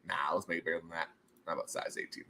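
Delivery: ordinary talk, nothing marked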